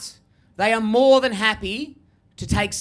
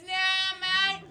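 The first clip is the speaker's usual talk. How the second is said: broad Aussie bloke; 10 semitones higher, 4 dB quieter than usual. Retold or imitated. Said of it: "he goes".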